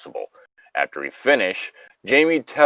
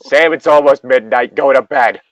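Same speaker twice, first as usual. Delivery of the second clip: louder, higher stern camp leader; stern scolding; 4 semitones higher, 6 dB louder than usual. Acted. Jamie ordering Sam to bed, stern and loud. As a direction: send him to bed with a firm raised voice.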